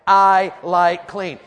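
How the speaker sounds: slow, loud, stretched out; slow call to repeat. Shown as a demonstration. Preacher leading the congregation in a slow, loud chant. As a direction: drag it out for the crowd to echo. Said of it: "Say it".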